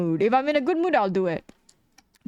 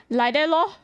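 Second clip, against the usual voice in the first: higher mock Singlish; 6 semitones higher than usual. To chat asking how she draws, a lilting Singlish lor. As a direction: toss off Like that lor in a lilting sing-song. Mocking own casual reply.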